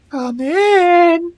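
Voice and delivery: high, muffled voice through a wall; muffled through a door. A narrator's reading. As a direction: call out from behind a closed door. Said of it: "a muffled voice that just sort of says".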